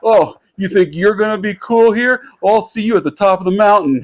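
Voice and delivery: mock movie-bully challenge; eighties ski movie villain. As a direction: sneer it like the rich jerk in an eighties ski film. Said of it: "eighties ski, uh, movie".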